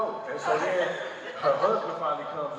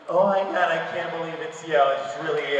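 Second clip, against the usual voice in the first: tipsy fan gushing; 5 dB louder than usual. Retold or imitated. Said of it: "And she was like".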